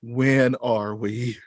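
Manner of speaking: very slow, drawn-out questions; mock bewilderment. Time travelers after the jump, hammed-up slow bewilderment. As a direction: stretch each word in hammed-up confusion.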